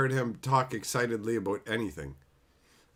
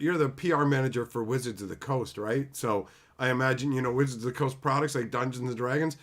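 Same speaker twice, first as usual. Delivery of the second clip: normal talking voice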